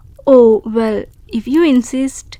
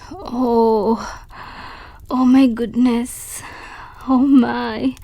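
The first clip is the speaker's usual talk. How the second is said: usual speaking voice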